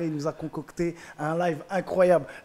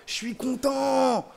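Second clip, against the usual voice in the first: content stretched out, slow and higher; emphatic; 7 semitones higher, much slower than usual. Real, not acted. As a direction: slowly lean on content. Stresses contentment at zero.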